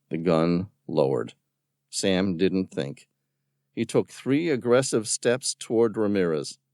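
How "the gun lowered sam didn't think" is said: plain reading voice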